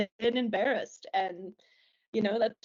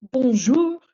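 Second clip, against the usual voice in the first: loud, pointed "Bonjour" with a sharp rise; frustrated bonjour; 5 semitones higher, 11 dB louder than usual. Retold or imitated. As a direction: scold her with one curt "Bonjour". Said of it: "in a really, like, frustrated voice".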